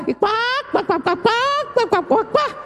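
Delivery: high mocking quack, cuac cuac; duck quacking. Preacher mocking chattering mourners with high duck quacks. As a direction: quack like gossiping ducks to mock them.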